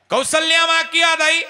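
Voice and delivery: high, loud, shouted worry; anxious calling. Acted. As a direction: demand to know why he cries. Rama questions weeping Lakhan.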